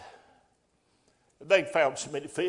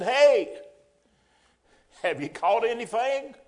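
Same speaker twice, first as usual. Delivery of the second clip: loud call across the water; 5 semitones higher, 5 dB louder than usual. Acted. Stranger on shore calling out to fishermen. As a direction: holler out to the boat. Figure on shore calls to boat.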